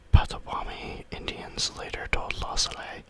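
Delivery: plain reading voice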